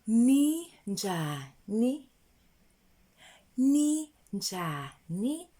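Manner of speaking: Ninjani stretched very slowly. Shown as a demonstration. Teacher slowly repeating ninjani for learners. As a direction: draw each syllable of Ninjani out. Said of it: "Can you say it?".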